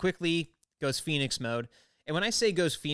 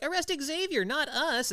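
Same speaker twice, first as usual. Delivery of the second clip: high mocking Jubilee voice; 12 semitones higher than usual. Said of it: "Jubilee's like".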